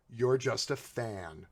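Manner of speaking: low, soft and slow, deadpan sneer; sarcastic punchline. Pretend. Mocking the double standard, low and deadpan. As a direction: let it drop, dry and slow.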